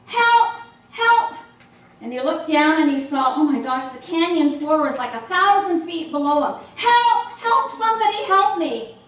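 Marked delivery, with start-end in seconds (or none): 0.0-1.3 high, loud cry for help; panicked yell from a cliff edge. Retold or imitated. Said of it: "he started to yell". 6.8-9.0 high, loud cry for help; panicked yell from a cliff edge. Retold or imitated. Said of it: "he started to yell".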